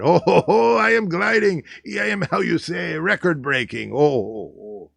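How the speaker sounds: put-on a jolly French aviator gliding, in French accent; playful French-accented boast; mock French pilot. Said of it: "French pilot".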